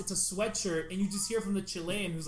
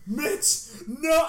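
loud, high yell of alarm; retold scream; 9 semitones higher, 9 dB louder than usual. Shouting at Mitch as he stands to vomit, loud high yell. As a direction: shout his name to stop him. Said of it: "Like, I scream".

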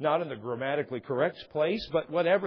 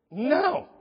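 loud, high firm no; emphatic no; 11 semitones higher, 6 dB louder than usual. Retold or imitated. Pastor refusing the street preacher, emphatic no. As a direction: shut it down flatly.

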